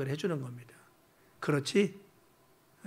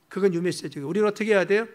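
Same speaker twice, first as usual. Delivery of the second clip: usual speaking voice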